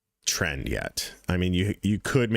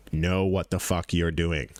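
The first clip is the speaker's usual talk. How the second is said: ordinary talk, nothing marked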